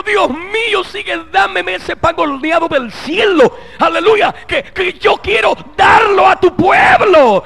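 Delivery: high, slow, rising to a shout; fervent prayer. Retold or imitated. Preacher voicing his daily prayer, high and shouting. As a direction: cry out to God with fervor. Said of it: "cada día que le digo".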